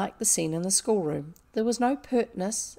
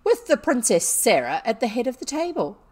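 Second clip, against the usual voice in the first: loud, higher-pitched mockery; withering; 4 semitones higher, 8 dB louder than usual. A narrator's reading. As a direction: sneer at the title scornfully. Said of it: "said Miss Minchin witheringly".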